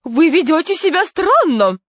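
high-pitched, affronted reproach; indignant exclamation. A narrator's reading. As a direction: protest at someone's odd behaviour. Nurse scolds the doctor.

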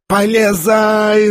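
high, drawn-out yell; acted roar. A narrator's reading. Ferdyshchenko urging Ganya into the fire, roaring. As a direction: bellow the order, stretching the word. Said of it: "заревел Фердыщенко".

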